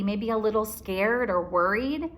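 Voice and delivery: usual speaking voice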